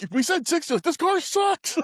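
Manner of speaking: very high, strained imitation; mock panicked protest. Friends gunning it at the bridge, mock panicked protest. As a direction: squawk it high, slowing on the complaint.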